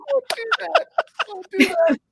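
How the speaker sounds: retold mumbling. Caller stumped by Spanish on the phone, mock mumbling. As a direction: mumble it high through stifled laughter. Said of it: "I just kept mumbling".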